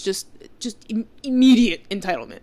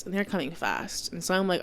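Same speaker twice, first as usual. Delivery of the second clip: ordinary talk, nothing marked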